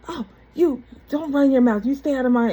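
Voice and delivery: imitating an angry scolding, high and loud; mad. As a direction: act out telling a child off. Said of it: "Then he would get mad".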